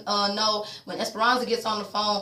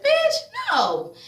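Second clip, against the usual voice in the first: incredulous refusal; 3 dB louder than usual. Real, not acted. Refusing mama's plan.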